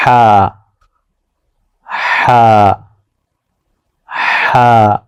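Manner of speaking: ہا breathed gently from the throat; soft ha. Shown as a demonstration. Teacher modelling a gentle breathy ہا to repeat. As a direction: let ہا out easily and softly. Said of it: "ایسے بہت آرام سے".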